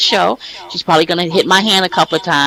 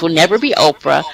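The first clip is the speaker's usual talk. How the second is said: usual speaking voice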